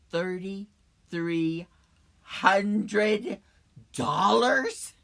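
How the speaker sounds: slow echo building to a loud, rising question; stunned disbelief. Acted. Car owner echoing the mechanic's bill, stunned disbelief. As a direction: repeat it word by word, ending loud and incredulous.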